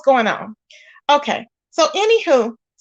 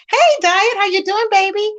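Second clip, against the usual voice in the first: high, affectionate hello to an arriving regular; high-pitched greeting; 9 semitones higher than usual. Real, not acted. Host welcomes a viewer to the livestream, high-pitched greeting.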